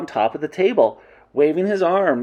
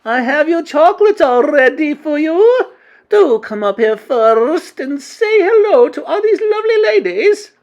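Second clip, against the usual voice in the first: high, loud, gushing witch voice; syrupy witch; 14 semitones higher, 7 dB louder than usual. A narrator's reading. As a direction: sweet-talk in a shrieky high pitch. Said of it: "absolutely dripping with syrup".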